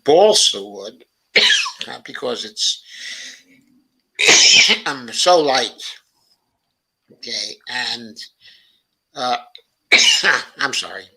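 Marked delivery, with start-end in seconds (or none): 1.3-2.0 coughing fit. Real, not acted. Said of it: "cough, coughing spell here". 9.9-10.5 coughing fit. Real, not acted. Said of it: "cough, coughing spell here".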